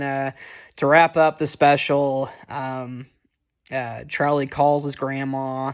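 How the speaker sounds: normal talking voice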